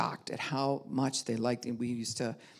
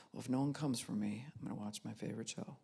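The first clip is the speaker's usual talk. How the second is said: much softer, mumbled to himself; muttered aside; 5 semitones lower, 12 dB quieter, faster than usual. Retold or imitated. Husband slinking off to his show, muttered softly. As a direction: trail off under the breath.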